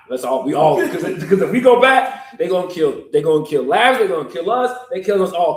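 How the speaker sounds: high and loud panic; loud alarm. Acted. Disciples fearing death in Jerusalem, loud and alarmed. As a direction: sound the alarm.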